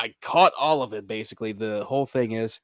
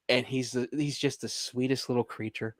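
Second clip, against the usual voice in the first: ordinary talk, nothing marked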